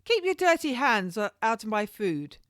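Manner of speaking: loud, high outburst; sharp shout across the kitchen; calling out. A narrator's reading. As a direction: snap at the searcher to stop. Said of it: "called out to him".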